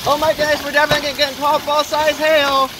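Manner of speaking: alarmed excitement. Real, not acted. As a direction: keep gasping it out in awe. Golf ball hail pounding down.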